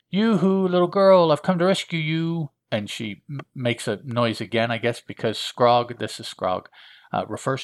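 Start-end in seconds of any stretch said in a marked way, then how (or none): 0.1-2.5 high, loud sing-out to someone; calling rescuer. Acted. Rescuer calls to captive girl, high loud yoo-hoo. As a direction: call out in a put-on high voice.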